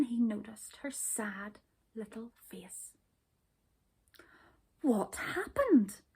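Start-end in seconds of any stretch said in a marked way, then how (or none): 4.8-6.0 slow, worried question; anxious. A narrator's reading. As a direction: ask it anxiously, a little higher. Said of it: "he asked anxiously".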